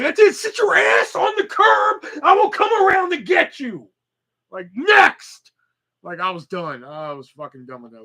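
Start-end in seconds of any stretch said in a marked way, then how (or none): 0.0-3.9 exasperated yelling. Retold or imitated. Acted blow-up at customer. 4.7-5.5 loud, high shout for the next customer; dismissive call. Retold or imitated.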